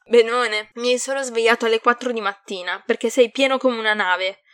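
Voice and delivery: loud, irritated irony; biting, exasperated sarcasm; sarcastic. A narrator's reading. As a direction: pile on the sarcastic complaint. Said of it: "ironizzò".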